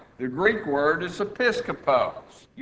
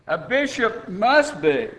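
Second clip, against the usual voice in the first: MUST pushed out higher and firmly; stress on MUST; 6 semitones higher, 3 dB louder than usual. Shown as a demonstration. Preacher showing the Greek is emphatic, stress on MUST. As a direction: lean hard on "must". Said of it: "It's emphatic".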